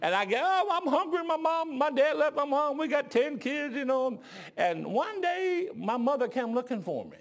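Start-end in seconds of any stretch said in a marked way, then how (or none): 0.4-4.2 high-pitched, whiny mock sobbing plea; fake cry. Hungry con-artist boy pleads for food, high fake crying. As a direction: beg with hammed-up, phony tears. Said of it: "I had a little fake cry".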